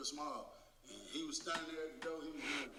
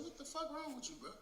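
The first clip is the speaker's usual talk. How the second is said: high-pitched retold disbelief; retold incredulous questioning; 13 semitones higher, faster than usual. Teller to his muttering friend, retold high-pitched incredulous question.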